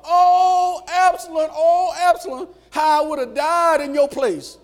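high, loud, drawn-out wail; hollering lament. Acted. David grieving his dead son, acted as loud hollering. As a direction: cry it out long and high. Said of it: "David started hollering".

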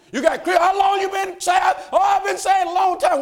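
high, loud sermon declaration; full-force preaching. Acted. Preacher declaring one truth to the church, full-force high preaching. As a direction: drive the truth home from the pulpit.

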